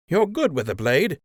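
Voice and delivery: surprised. A narrator's reading.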